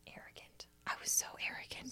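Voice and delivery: breathy aside whisper; whispered self-mockery. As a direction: hush it like a confession. Mocking her own arrogance.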